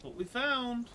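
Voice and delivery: excited. Real, not acted. Loot found in game.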